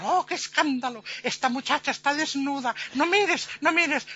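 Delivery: high-pitched, hammed-up outrage; scandalised ladies. Ladies shocked at the naked dancer, high scandalised voice. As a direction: fret at your husband to look away. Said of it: "y las señoras".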